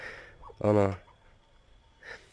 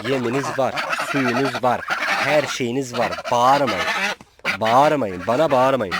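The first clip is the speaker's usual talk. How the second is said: loud, firm telling-off to noisy animals; scolding shout; 2 semitones higher, 6 dB louder than usual. Real, not acted. Farmer scolds his honking geese, loud and stern. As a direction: shout them down and make them stop.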